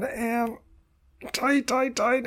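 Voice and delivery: very high, squeezed repeats; high, tight voice holding the tension; tensing up. Shown as a demonstration. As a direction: strain upward as if every muscle is clenched. Voices muscles tensing up again.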